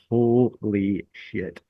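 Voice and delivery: drawn-out, slow disbelief; stunned reaction. Retold or imitated. Brother and friend find him hurt on the dock, retold stunned slowness. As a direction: stretch each word slowly, in hushed shock. Retold shock at seeing injury.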